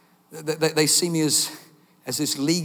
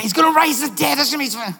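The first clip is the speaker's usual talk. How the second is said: higher and louder, eager certainty; excited guess; 8 semitones higher, 5 dB louder, faster than usual. Retold or imitated. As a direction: blurt it out like a sure bet. Angels guessing, excited retake.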